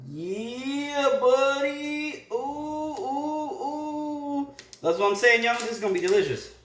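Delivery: very high, thrilled squeals; excited. Real, not acted. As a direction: squeal with delight at the food. Tasting pizza, thrilled.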